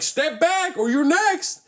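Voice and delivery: high, slow, drawn-out warning; retold cop threat. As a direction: imitate an officer's final warning, stretching the words.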